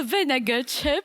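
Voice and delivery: put-on British pronunciation; British accent. Shown as a demonstration. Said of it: "I'll give you some British accent".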